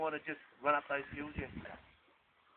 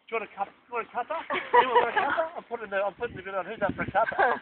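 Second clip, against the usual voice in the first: bright, offhand, fast at the end; hammed-up mate offering a cuppa; cheery Aussie offering tea; 7 semitones higher, 8 dB louder, faster than usual. Guide voicing Aussie officers at the truce, chirpy. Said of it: "would open the plate and say".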